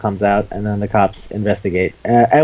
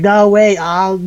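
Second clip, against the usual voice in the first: higher, louder mock panic; flustered protest; 8 semitones higher, 4 dB louder, slower than usual. Accused man to teasing spider girl, flustered protest. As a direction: act out a flustered denial.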